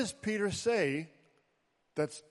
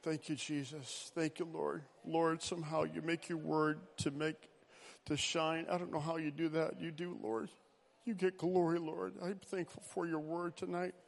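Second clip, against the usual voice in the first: quiet prayer; 9 dB quieter than usual. Acted. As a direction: pray gently, almost under breath. Soft closing prayer.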